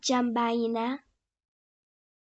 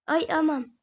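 ordinary delivery

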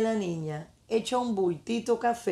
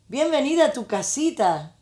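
exclaimed welcome; 6 semitones higher, 3 dB louder than usual. A narrator's reading. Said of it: "la niñita le exclamó".

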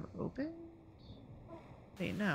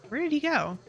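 ordinary delivery

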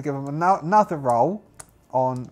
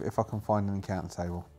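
ordinary talk, nothing marked

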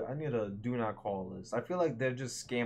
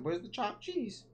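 high voiced repeat; 9 semitones higher, 5 dB quieter than usual. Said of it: "Oh, I said".

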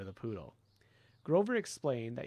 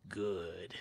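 low ancient murmur; 5 semitones lower than usual. Acted. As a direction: murmur it, pleased and evil. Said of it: "it murmured".

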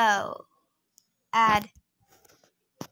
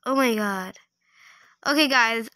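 usual speaking voice

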